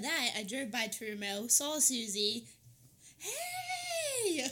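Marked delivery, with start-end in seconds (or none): 3.2-4.5 high, laughing yelled greeting; yelling across the room. Retold or imitated. Mimics Susie's loud greeting.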